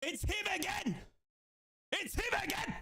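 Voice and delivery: high, exultant repeated call; elated cry. Real, not acted. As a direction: cry it high, thrilled it's him. Celebrating the scorer.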